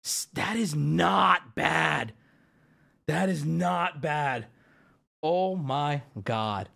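slow, drawn-out words; slow, impressed disbelief; awed approval. Real, not acted. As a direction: weigh each word in amazement. Awed inspection of the card.

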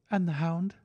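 high-pitched, worried woman; anxious woman's voice. A narrator's reading. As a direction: ask anxiously in a high female voice. Narrator voices anxious Mrs Stapleton.

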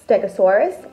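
ordinary delivery

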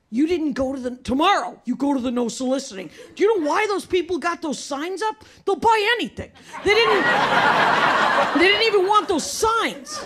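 mock outrage, rising as the rant goes on; freaked-out dad. Dad lecturing child about unsold candy bars, freaking out. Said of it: "he freaked out".

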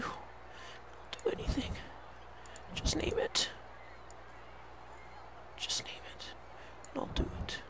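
whispered, slowed pleading; desperate whisper. Real, not acted. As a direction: breathe it out like a beaten man begging. Desperate plea to the wolves.

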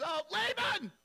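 very high and loud outraged wail; cheated groom shrieks at father-in-law; furious whine. Retold or imitated. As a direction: screech at him for the trick. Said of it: "he flips out".

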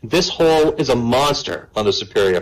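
normal talking voice

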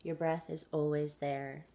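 measured meditation guide with long pauses; guided meditation. Acted. Guide asking listeners to follow the breath, guided meditation. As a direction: lead them gently through it, unhurried.

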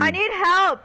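urgent call for help; panicked shout. Real, not acted. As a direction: yell for help. Calling out in game panic.

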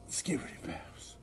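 breathy whispered chant. Pretend. Whispering silly words to wake the room. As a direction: whisper the nonsense words like a secret.

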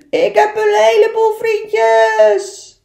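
high, loud and slow, calling across the lake; happy shout. Acted. Little beaver calling across the lake, joyful loud shout. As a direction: shout it out with joy, drawn out. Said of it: "dat hij het ook nog eens heel hard riep".

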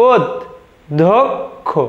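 ওদ, ধোক, খো with long pauses between; correct pronunciation, syllabified. Shown as a demonstration. Teacher showing students the correct pronunciation, one syllable at a time. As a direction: say ওদ then ধোক then খো, each apart. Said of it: "আমাদের শুদ্ধ উচ্চারণটা দেখে নাও".

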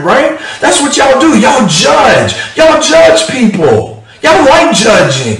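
high, loud, mocking sarcasm; sarcastic. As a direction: needle the judges with loud mockery. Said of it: "it's, it's sarcastic".

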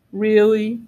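low, level dinosaur reply; big dinosaur. A narrator's reading. Big dinosaur confirming to the youngster, low firm answer. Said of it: "said the big dinosaur".

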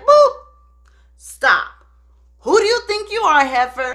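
imitating an affronted wife, punchy and loud; mock indignant challenge. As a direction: shut a rival down, hammed-up. Acted interruption confronting a rival.

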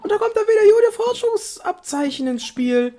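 high put-on Ampharos voice; lightly voiced friendly Ampharos; disguised Ampharos voice. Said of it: "Mit verstellter Stimme".

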